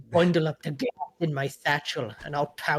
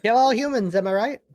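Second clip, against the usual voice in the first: loud cheery villain robot; rally the robots; loud robot rally cry; 3 semitones higher, 8 dB louder than usual. Pretend. Robot joke line.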